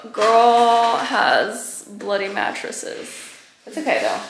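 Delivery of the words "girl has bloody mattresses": slow dramatic. Real, not acted.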